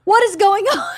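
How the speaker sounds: retold demand to know what's happening; alarmed demand. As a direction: burst in on an argument. Mother walks into shouting match.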